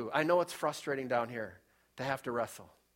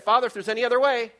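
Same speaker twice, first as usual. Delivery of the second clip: higher, much louder, fast anguished plea; pleading Jesus; 10 semitones higher, 12 dB louder, faster than usual. Retold or imitated. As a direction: cry out to the Father in anguish.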